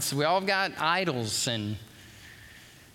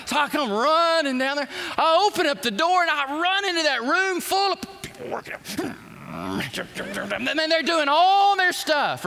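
excited retelling; 11 semitones higher, 5 dB louder than usual. Acted. Retelling rushing into gym.

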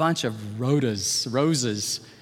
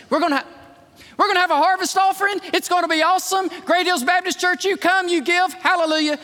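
much higher, louder, fast pitch; hyped announcement; 13 semitones higher, 4 dB louder, faster than usual. Acted. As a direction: sell it like a rally cry, fast.

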